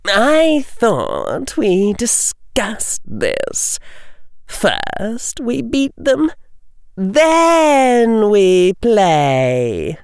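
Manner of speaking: very slow, high, drawn-out cooing; sugary villainess. Acted. Belka reins in Jugson, slow sadistic sweetness. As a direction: chide your partner sweetly, savouring the cruelty. Vicious bully's speech, narration beside.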